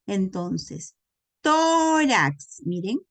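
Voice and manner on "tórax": tórax with TÓ high, loud and drawn out; stress on penultimate syllable. Shown as a demonstration. Teacher demonstrating llanas, strong stress on the TÓ of tórax. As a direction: call out TÓ-rax, stretching the first syllable. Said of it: "lleva mayor fuerza de voz en la penúltima sílaba".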